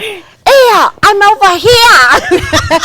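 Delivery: high, loud, drawn-out holler; hammed-up imitation of a relative hollering; mother-in-law calling out. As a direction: call down the street, stretching it slowly. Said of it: "And she's like".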